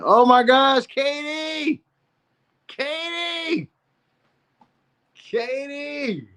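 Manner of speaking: very high, drawn-out squeal of the name; hammed-up scandalized cry, high and stretched; mock shock. Reacting to Katie's pregnancy confession, mock-shocked squeal.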